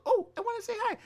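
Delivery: very high, squeaky little squirrel voice; a tiny critter piping up to say hello. Acted. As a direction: play a cute squirrel, far up in pitch. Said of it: "like, little squirrel".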